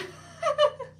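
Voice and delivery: squealing high laugh; high-pitched crazy laugh. Real, not acted. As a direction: burst into a shrill giggle. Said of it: "this really crazy, like, high-pitched laugh".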